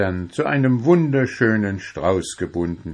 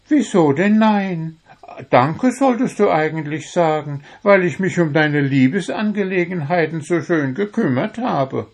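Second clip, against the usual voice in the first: much higher, pleased with himself; Wuschel, proud; 8 semitones higher than usual. A narrator's reading. Wuschel tells Puh he delivered the love letters, high and proud. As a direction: brag cheerfully about the good deed.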